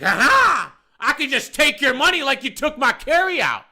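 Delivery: imitated high, gloating taunt; loud taunt. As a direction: crow it loudly like a spiteful customer. Acted taunt of customer grabbing money.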